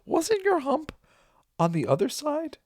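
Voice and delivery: a bewildered doctor noticing the hump moved; imitating Gene Wilder. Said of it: "Gene Wilder's like".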